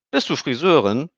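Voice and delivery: put-on high, loud girl's voice; a child stating a fact; little girl. A narrator's reading. Emmy notices Stefan bleeding, high girl's voice.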